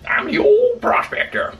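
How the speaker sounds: put-on high, slow old miner voice; old prospector voice. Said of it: "my old prospector voice".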